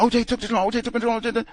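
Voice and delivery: high, rapid mock babbling; mock frantic accuser. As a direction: imitate someone blurting a panicked accusation. Mimics Susan Smith's invented accusation.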